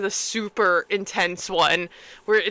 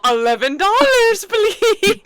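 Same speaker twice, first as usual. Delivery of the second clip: much higher, louder, sing-song request; sweet demanding voice; 10 semitones higher, 6 dB louder, slower than usual. Retold or imitated. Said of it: "She's all".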